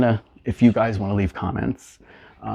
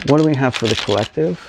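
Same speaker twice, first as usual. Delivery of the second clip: raised, higher invocation; calling out; 5 semitones higher, 5 dB louder, faster than usual. Acted. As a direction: call out to the spirit. Calling on spirit for reading.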